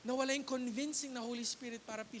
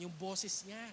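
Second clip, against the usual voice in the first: usual speaking voice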